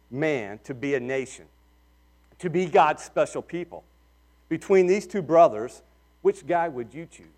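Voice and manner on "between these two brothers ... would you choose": ordinary talk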